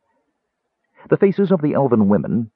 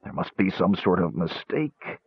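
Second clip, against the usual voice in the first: soft, lost mumble; mumbling; 2 semitones lower, 7 dB quieter than usual. A narrator's reading. Caramon overwhelmed by shame and fear, mumbling. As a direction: mumble it softly, half to yourself. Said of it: "Tass heard him mumble".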